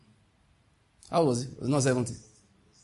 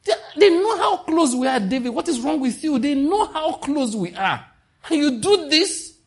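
high, loud indignant rebuke; mock outraged God; 11 semitones higher, 4 dB louder than usual. God rebuking David, high, loud and indignant. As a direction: scold him like a wounded friend. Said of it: "God told, he said".

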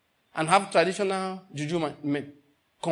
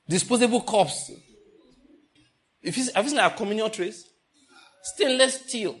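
loud, indignant rant in higher pitch; worked-up anger; 3 semitones higher, 5 dB louder than usual. Real, not acted. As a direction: let rip in defence of your church, half amused. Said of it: "I just got angry for nothing".

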